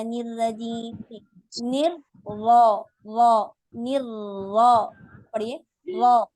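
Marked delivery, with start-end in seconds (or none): 1.6-6.3 निर रा said slowly and loud with a clear break between; slow loud drill of निर रा; separated syllables. Shown as a demonstration. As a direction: split निर and रा apart, stressed. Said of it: "निर राजीम, राजीम नहीं पढ़ना".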